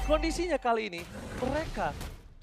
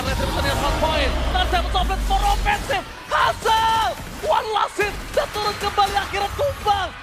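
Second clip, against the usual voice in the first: hyped shouting play-by-play; esports caster; 7 semitones higher, 6 dB louder than usual. Real, not acted. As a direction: yell the kill call. Caster yells kill in teamfight.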